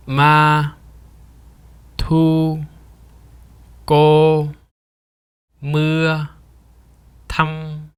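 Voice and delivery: "ma, tu, ko, mưa, thăm" each held on one high, level pitch; "ma, tu, ko, mưa, thăm" as separate, unwavering level syllables; flat tone demonstration. Shown as a demonstration. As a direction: chant each of "ma, tu, ko, mưa, thăm" like a sustained note. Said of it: "Here are some example words".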